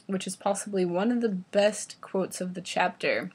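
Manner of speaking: ordinary delivery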